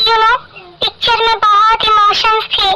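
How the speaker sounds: ordinary talk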